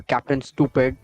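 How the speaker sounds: ordinary talk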